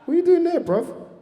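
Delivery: shocked laughing phone question; laughing disbelief. Retold or imitated. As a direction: shriek in amused shock. Speaker retells shocked reply.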